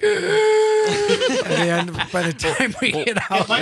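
high drawn-out laughing words. Real, not acted. Laughing about hauling decoys.

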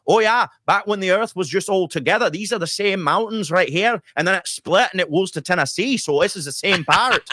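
lightly voiced Scottish local; Scottish accent. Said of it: "That's a really good Scottish accent".

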